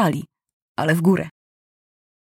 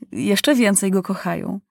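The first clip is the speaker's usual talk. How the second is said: ordinary delivery, nothing marked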